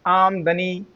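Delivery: आमदनी broken into आम-दनी; broken mispronunciation. Shown as a demonstration. Teacher showing how people break it, आमदनी as आम-दनी. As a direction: break आमदनी right after आम. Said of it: "तोड़ देते हैं".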